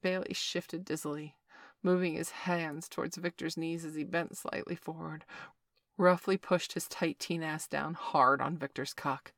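usual speaking voice

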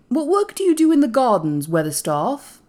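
high, loud, condescending question; lordly young master. A narrator's reading. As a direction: inquire like a little rajah. Colin as condescending Rajah inquiring.

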